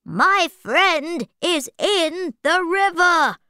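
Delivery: high, urgent cat plea. Acted. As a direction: plead like an anxious cat. Puss calls to the king.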